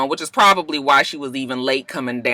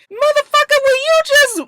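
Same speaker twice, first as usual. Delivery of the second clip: exasperated rant; 5 dB louder than usual. Real, not acted. Ranting about picky eaters.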